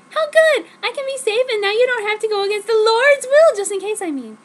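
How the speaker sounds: put-on very high, loud girly squeal; squealing delight. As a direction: shriek with joy at the news. Narrator voices herself in quotes.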